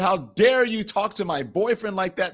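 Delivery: put-on high-pitched indignant girlfriend; confronting. Girlfriend confronting him over her boyfriend, mock indignant. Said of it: "getting in my face".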